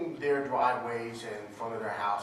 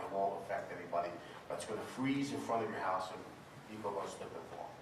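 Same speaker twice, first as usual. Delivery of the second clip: ordinary talk, nothing marked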